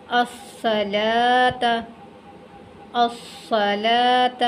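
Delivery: अस्सलात slowly, doubled s and long laa; silent waw. Shown as a demonstration. Teacher models word for learners, slow recitation. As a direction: hold laa in अस्सलात, no w sound. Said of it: "ये वाला वाव भी नहीं पढ़ेंगे".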